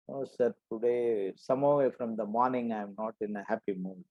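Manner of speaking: a lightly voiced downcast pupil answering sir; glum student reply. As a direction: sigh it out like a student in a bad mood.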